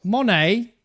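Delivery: light mock accent drifting British; imitating her accent. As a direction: copy Stella's accent in one word. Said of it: "more British than Australian".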